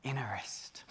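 soft unvoiced whisper. Preacher whispering to the congregation, savouring the word. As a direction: breathe it out like a secret.

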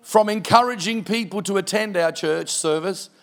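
full pulpit preaching. Acted. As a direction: drive the point home to the congregation. Preaching the closing application.

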